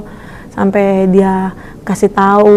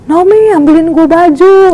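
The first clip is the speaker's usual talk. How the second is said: imitating a man's yell, pitched up and drawn out; teriakan memanggil; 7 semitones higher, 3 dB louder, slower than usual. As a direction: call out to someone in another room, higher and slower. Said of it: "Dia teriak". (menirukan teriakan memanggil)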